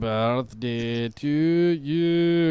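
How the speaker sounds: sung slowly in Scottish accent; drawn-out Scottish accent singing. Shown as a demonstration. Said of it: "sing Happy Birthday with a Scottish accent".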